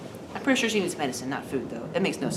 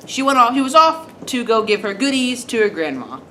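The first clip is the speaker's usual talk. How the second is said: ordinary delivery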